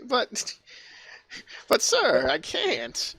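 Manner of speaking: high, halting, helpless protest; flustered assistant. Acted. Assistant pleads with angry visitor.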